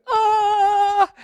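very high, loud, drawn-out squeal of realisation; high awed "aah". Acted. Preacher mimicking readers spotting Jesus in Zechariah, high excited squeal. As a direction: let out a long high "aah". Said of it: "did any of you kind of go".

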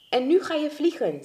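high, eager command; urging on. Acted. Pushes friend to fly.

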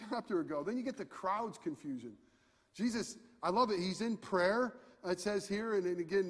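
ordinary talk, nothing marked

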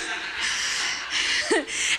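soft breathy laugh; real soft airy giggle. Real, not acted. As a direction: let a quiet laugh slip out. Laughing at her own joke.

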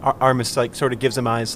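ordinary talk

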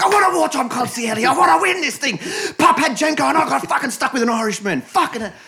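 very high, loud movie rant; explosive mock tirade; furious acted shouting. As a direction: yell it out like a raging mob boss. Acting out Sonny's furious outburst.

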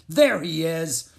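slow, loud gruff groom. A narrator's reading. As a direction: call it out gruffly and slowly. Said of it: "a gruff voice said".

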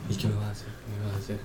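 lightly voiced anxious old woman; hint of a frightened elderly patient; confused old lady.

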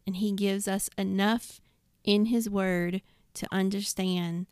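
ordinary talk, nothing marked

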